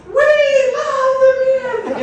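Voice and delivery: very high, very loud drawn-out yell; player calling to band. Retold or imitated. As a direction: belt it out to the whole band. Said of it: "she looks at me and she goes".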